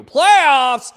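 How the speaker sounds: loud playoffs shout; loud hype. Real, not acted. Host hyping fans for the playoffs. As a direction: blast the word like a chant.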